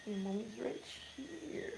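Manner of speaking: soft reassuring coo. Real, not acted. Reassuring the baby.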